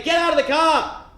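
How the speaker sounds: high, loud barked police order; police shout. Retold or imitated. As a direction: yell the order like a cop. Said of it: "they say".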